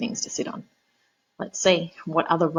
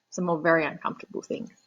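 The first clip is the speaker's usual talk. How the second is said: ordinary delivery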